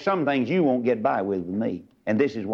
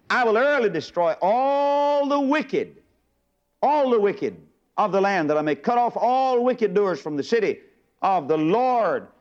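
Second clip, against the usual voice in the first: high, slow thundering verse; fervent sermon climax; fiery preaching; 7 semitones higher, slower than usual. Real, not acted. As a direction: proclaim the judgment slowly from the pulpit. Preaching the psalm at full force.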